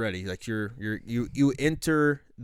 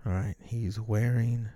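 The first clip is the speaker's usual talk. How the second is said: slow instructing; 5 dB quieter, slower than usual. Acted. Handler instructs sniper over radio.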